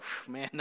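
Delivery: sigh of relief. Retold or imitated. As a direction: let the breath out in relief. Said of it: "you breathe that sigh of relief".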